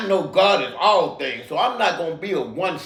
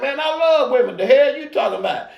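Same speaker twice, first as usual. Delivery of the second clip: laughing retort; 6 semitones higher than usual. Retold or imitated. Retorts to preaching stranger, laughing.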